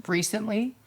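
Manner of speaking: ordinary talk, nothing marked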